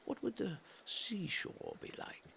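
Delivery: ordinary delivery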